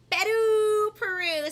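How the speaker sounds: gasp, then Peru called out high and loud; thrilled announcement; excited. Real, not acted. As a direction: burst out with Peru like a big reveal. Her family's country named last.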